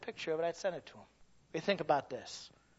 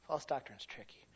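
quiet echo; 4 semitones lower, 9 dB quieter than usual. Acted. Repeated softly after whisper.

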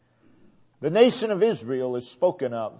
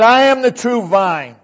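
preaching declaration; 7 semitones higher, 8 dB louder than usual. Acted. Preacher declaring at full force.

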